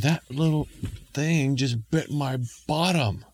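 mild indignation. Acted. Bitten man complains of imp.

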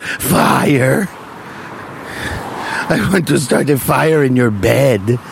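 put-on low German-accented creepy child. Ponce confessing his urge to mama, in a German accent. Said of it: "I don't know why he's German".

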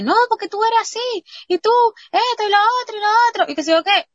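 very high, loud mock-nagging voice; nagging girlfriend. Girlfriend scolding her boyfriend, high-pitched rapid mock rant. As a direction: imitate her rattling off complaints in a high pitch. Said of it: "Y ella dice".

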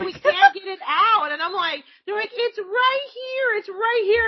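high-pitched retold panic; imitating flustered coworkers; managers' urgent plea.

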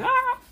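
high mock yelp; a squeaky imitated cry; hammed-up startled shriek. Mimicking the convulsing guy.

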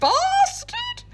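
very high shrieked echo; squealed mock outrage. Friend echoing the insult in a high shriek. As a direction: shriek it back in mock shock.

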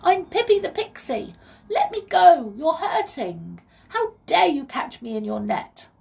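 high, indignant little pixie voice; Pippi the Pixie. A narrator's reading. Pixie scolds the boy who caught her, tiny indignant voice. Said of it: "said a tiny high voice".

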